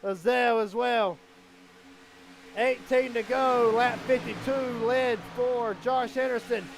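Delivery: urgent race announcer calling every move; excited race play-by-play. Real, not acted. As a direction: drive the commentary up to shouted peaks.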